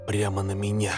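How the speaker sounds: ordinary delivery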